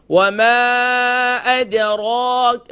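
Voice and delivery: slow melodic tajweed recitation; Quran recitation. Shown as a demonstration. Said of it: "ہم پڑھتے ہیں". (قرآن کی تلاوت)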